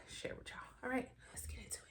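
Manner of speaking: soft whisper. Real, not acted. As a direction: whisper it like a secret. Whispered aside before opening.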